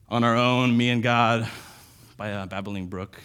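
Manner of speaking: mock self-reliance. Mocking individualistic view of faith.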